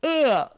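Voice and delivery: a loud, high yawning groan; real yawn groan. Real, not acted. Host caught by a yawn while reading about yawning. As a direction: let a big yawn out. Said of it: "I've been yawning".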